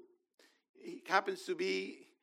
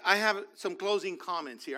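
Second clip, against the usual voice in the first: ordinary talk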